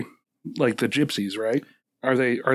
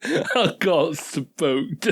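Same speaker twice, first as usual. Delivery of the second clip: laughing; 2 semitones higher, much slower than usual. Acted. Echoing through laughter.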